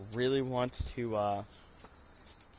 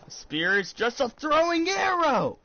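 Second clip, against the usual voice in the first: slow, much higher and louder yelled declaration; big shout; 9 dB louder, slower than usual. Real, not acted. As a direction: belt it out like a bold proclamation. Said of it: "Get my breath ready".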